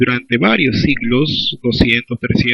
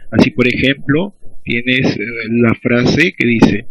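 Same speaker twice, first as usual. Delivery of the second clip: ordinary talk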